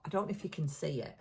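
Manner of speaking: usual speaking voice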